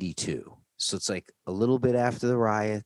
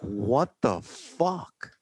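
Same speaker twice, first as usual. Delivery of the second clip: slow, drawn-out disbelief; bewildered; 5 semitones higher, much slower than usual. Retold or imitated. Kid baffled as the cops drive off, slow disbelief. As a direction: stretch it out, baffled.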